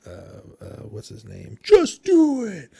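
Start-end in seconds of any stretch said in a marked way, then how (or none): 1.6-2.7 high, loud, slow shouted slogan; Shia LaBeouf shout. Retold or imitated. A podcaster shouts the famous meme line to his cohost, mock yell. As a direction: bellow the catchphrase like the meme.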